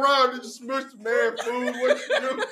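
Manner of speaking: laughing disbelief. Retold or imitated. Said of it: "I said".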